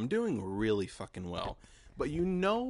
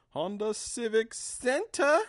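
sing-song announcement, much higher and slower; high drawn-out call; 6 dB louder, slower than usual. Real, not acted. Host announcing the venue to listeners, high and drawn out. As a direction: stretch it out high and slow, like announcing it. Calls out venue name found.

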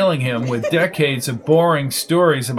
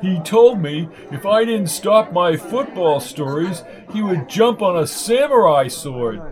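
higher-pitched blowhard complaining; pitched-up, indignant character voice; aggrieved old bore; 5 semitones higher than usual. Acted. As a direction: grumble like a wounded windbag. Lark tells of threat.

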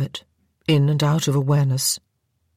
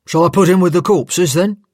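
louder, hard-to-follow soldier's voice; a blunt bearer asking flatly; stretcher-bearer asking the nurse what to do; rough stretcher-bearer; 2 semitones higher, 6 dB louder than usual. Acted. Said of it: "Another voice, nearly as unintelligible".

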